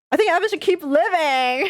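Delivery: high, loud, half laughing, half crying; laughing-crying plea. Real, not acted. Player choosing that the boy should live, laughing and crying. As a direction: blurt it out through tearful laughter. Player picks choice emotionally.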